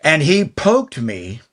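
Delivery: ordinary talk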